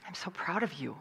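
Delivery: whispered, heartfelt praise; hushed words of pride; tender whisper. Acted. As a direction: take a moment and whisper it. Taking time to say it tenderly.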